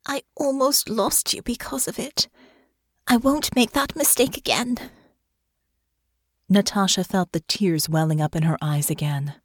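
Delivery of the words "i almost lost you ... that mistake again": Honor's voice. Acted. Honor's dialogue in narrated fiction.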